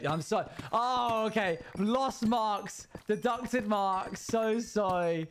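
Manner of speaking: slow, high-pitched mock regret; overdone drama-student sorry; mock apology. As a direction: draw out a hammed-up apology up high. Mock exam failure, apologising.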